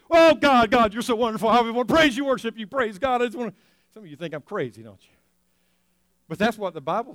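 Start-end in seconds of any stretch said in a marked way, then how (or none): 0.1-3.5 high, loud, gushing praise; ecstatic worship. Acted. Churchgoer praising God like an overjoyed dog, ecstatic. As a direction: pour it out in overexcited mock rapture.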